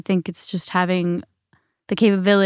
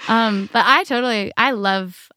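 usual speaking voice

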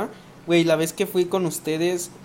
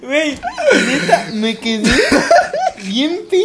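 words squeezed out through real laughing; loud, high, breaking off to laugh; can barely get the words out for laughing; helpless laughter; 8 semitones higher, 7 dB louder than usual. Real, not acted. Too amused to continue story.

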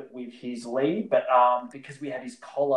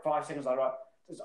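usual speaking voice

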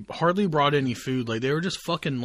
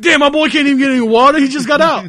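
high, loud laughing talk; 11 semitones higher, 11 dB louder, faster than usual. Real, not acted. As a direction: squeeze the words out between laughs. Laughing at the joke.

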